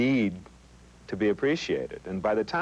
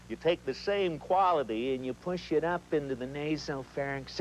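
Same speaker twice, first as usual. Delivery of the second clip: normal talking voice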